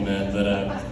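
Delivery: ordinary talk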